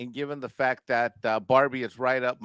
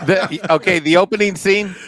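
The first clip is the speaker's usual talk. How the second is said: laughing; 5 semitones higher, 9 dB louder than usual. Real, not acted. Laughing while admitting.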